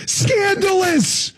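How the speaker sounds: high, slow mock outrage; sarcastic gasp. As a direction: gasp it in fake shock. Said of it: "if you're sensing the dripping sarcasm".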